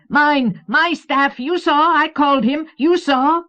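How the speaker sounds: very high, loud frantic protest; crying out. A narrator's reading. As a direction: screech your claim up at a rival. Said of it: "jabbed his face toward the ceiling and cried".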